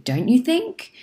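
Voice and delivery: casual rhetorical lift on "think". Shown as a demonstration. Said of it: "if you say it in the right tone".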